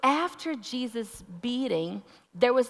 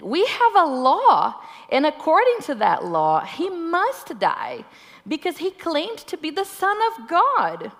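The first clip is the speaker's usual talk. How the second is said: louder, higher, firm insistence; insistent; 9 semitones higher, 7 dB louder than usual. Acted. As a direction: press the point hard.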